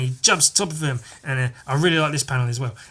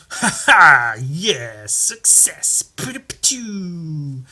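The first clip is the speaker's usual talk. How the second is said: mock triumph; 2 semitones higher, 5 dB louder, much slower than usual. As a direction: celebrate like a hammed-up hero, ending in slow noises. Hero's acted victory.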